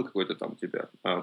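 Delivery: ordinary delivery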